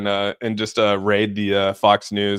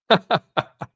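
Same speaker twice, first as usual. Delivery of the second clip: high burst of laughter; 11 semitones higher than usual. Real, not acted. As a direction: laugh at the chat. Streamer laughing at chat.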